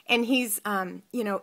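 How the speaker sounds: ordinary delivery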